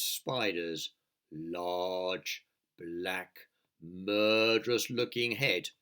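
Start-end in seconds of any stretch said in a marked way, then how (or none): none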